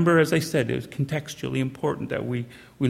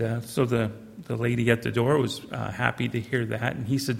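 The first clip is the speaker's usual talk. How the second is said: normal talking voice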